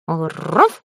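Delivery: rising growled woof with rolled r; dog's bark. A narrator's reading. Little dog agrees about his colour, rising bark. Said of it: "согласился пёсик".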